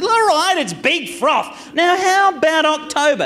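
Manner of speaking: high, strained exasperated retort; acted-out fed-up reply, pitched high. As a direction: concede impatiently and drag the talk back. Exasperated with John, back to business.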